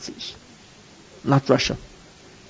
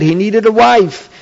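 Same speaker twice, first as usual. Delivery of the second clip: ordinary talk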